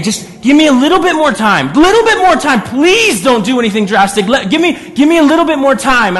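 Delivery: high, loud, begging voice; desperate pleading. Retold or imitated. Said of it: "I can just hear him saying".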